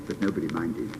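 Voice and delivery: ordinary delivery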